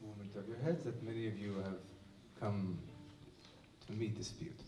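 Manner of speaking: normal talking voice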